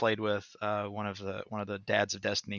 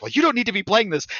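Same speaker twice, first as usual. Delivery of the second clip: higher, louder scolding mother; mother ending her son's game; stern mom; 12 semitones higher, 8 dB louder than usual. Retold or imitated. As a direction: shut it down like a mom. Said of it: "And she's like".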